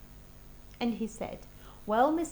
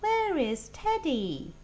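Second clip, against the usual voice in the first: mother asking; 8 semitones higher, 7 dB louder, slower than usual. Acted. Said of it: "Then she said".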